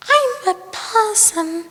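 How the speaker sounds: high, lightly voiced little possum. Possum answering the frog, high squeaky voice.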